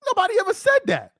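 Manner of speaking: high, fast exasperated denial; squeaky, rushed protest. Real, not acted. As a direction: blurt it out high and quick, fed up. Exasperated repeat of his rebuttal.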